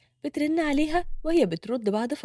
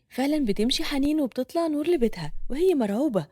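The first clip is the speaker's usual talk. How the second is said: ordinary delivery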